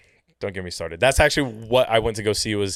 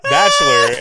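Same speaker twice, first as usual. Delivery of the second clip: laughing tease; 11 dB louder than usual. Retold or imitated. Donna teasing, laughing.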